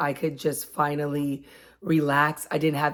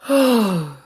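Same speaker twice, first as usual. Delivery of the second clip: loud, breathy yawn-sigh ending in a higher oh; 4 semitones higher, 7 dB louder than usual. Shown as a demonstration. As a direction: show the weird yawn noise. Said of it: "then be like".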